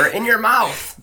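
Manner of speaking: high, slow, drawn-out retort; mock indignation. Co-host quotes him answering harassment at work, indignant. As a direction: imitate an aggrieved man protesting high. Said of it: "he's like".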